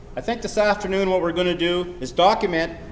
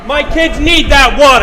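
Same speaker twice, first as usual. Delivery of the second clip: desperate shout, higher; 8 semitones higher, 12 dB louder than usual. Acted. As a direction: yell it over the crowd.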